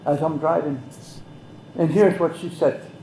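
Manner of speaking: normal talking voice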